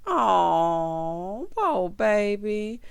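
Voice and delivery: long drawn-out sympathetic aww; pitying coo. Real, not acted. Mock cooing over the child.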